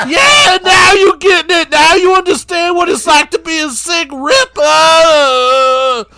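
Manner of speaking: Sick Ripper voice. Retold or imitated. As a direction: squeal it like a giddy maniac.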